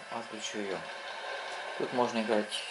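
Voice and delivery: normal talking voice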